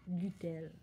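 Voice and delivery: ordinary delivery, nothing marked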